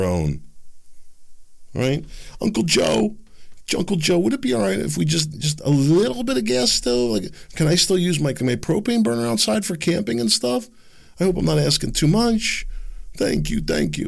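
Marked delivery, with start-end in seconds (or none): 2.4-14.1 high, timid begging voice; simpering citizen asking the president's leave; mock pleading. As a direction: grovel sweetly to Uncle Joe for permission. Mock pleading to Uncle Joe.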